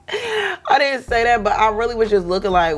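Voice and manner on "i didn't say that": laughing. Real, not acted. Laughs off her own remark.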